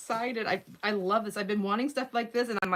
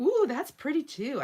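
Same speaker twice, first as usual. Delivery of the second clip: higher, slower burst of delight; light up at something pretty; a sudden delighted coo; delighted ooh; 8 semitones higher, 5 dB louder than usual. Real, not acted. Spots a pretty paper mid-sentence.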